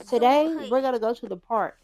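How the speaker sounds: loud, high, excited big-sister voice; cheery announcement. Pretend. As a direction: announce the outing brightly to the kids. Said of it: "So she's like".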